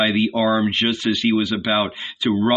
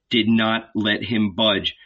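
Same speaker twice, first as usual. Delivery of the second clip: ordinary delivery, nothing marked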